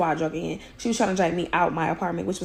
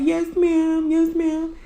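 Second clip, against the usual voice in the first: higher-pitched mock pleading; imitating her begging; 8 semitones higher, much slower than usual. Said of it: "she up there begging and pleading".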